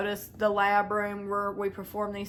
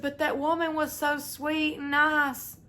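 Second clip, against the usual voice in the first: pleading quote; 6 semitones higher than usual. Retold or imitated. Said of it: "She said".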